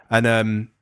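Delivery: usual speaking voice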